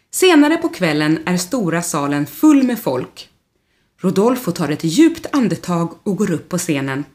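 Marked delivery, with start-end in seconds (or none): none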